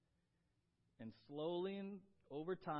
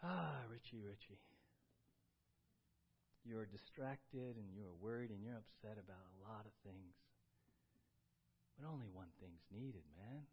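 much softer and lower, kind; tender gentle voice; 8 semitones lower, 9 dB quieter than usual. Retold or imitated. Jesus speaking tenderly to the distracted pastor, soft and low. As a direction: speak it like a friend at your shoulder. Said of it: "we might just hear him say".

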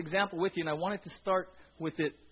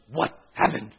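a hint of a grouchy gorilla, loud; loud grumpy bark, lightly voiced; grouchy Kerchak bark; 6 semitones lower, 8 dB louder than usual. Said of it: "I would say it kinda like that".

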